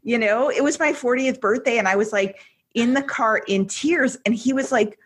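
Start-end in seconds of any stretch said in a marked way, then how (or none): none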